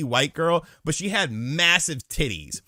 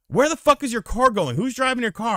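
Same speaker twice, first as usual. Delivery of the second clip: mock yelling girlfriend; 12 semitones higher than usual. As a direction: demand it like a panicked girlfriend.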